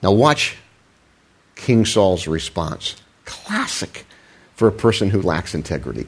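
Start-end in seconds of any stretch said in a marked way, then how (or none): 3.2-4.0 breathy whispered aside. Real, not acted. Preacher whispering an aside to the congregation. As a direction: drop to a whisper for emphasis. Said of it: "unvoiced, high".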